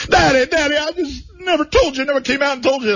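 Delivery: high, swooning son's voice; mock son's confession. Son confesses to his dad, high and swooning. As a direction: coo it up high like a nervous son.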